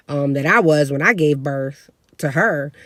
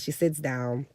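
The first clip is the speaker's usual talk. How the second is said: ordinary talk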